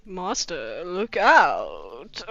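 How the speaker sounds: a feeble servant elf calling out, badly faked; funny Dobby voice. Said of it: "funny voice for Dobby".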